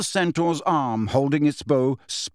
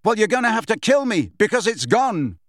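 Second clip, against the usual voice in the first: raised, higher-pitched shout; roared defiance; 8 semitones higher, 4 dB louder than usual. A narrator's reading. Harry defying the witch over prophecy, roaring. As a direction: throw it back defiantly, voice raised. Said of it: "Harry roared".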